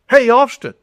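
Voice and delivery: cheerful hail from a distance; calling out a greeting. Retold or imitated. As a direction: sing out his name to get his attention. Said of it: "When somebody goes".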